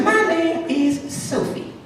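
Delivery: slow, dainty high falsetto; Sophie the rabbit. Acted. Freed rabbit introducing herself to the mouse, slow high falsetto. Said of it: "And she says".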